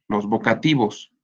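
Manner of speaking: ordinary talk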